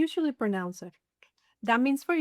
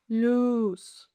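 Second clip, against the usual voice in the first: LUZ with a long, held "oo"; slow, sustained "Luz" for the listener; drawn-out demonstration. Shown as a demonstration. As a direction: stretch the vowel in "Luz". Word stretched for listening practice.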